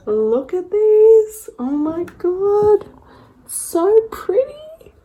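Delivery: high, slow, gushing; high and drawn out, ending in a whisper. Real, not acted. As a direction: swoon over the colours. Gushing over colors.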